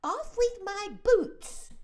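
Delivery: very high, loud, slow urgent shout; centipede shouting. A narrator's reading. The centipede yells to be freed of his boots, loud. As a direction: yell it in a frantic hurry. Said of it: "shouted the centipede".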